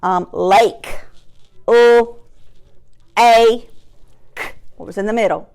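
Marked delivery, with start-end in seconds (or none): 1.7-4.5 "U-, a-k" as three separate held sounds; chopped word. Shown as a demonstration. Teacher chopping a word for pupils, sounds split apart. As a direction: chop LAKE with gaps. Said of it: "let's chop".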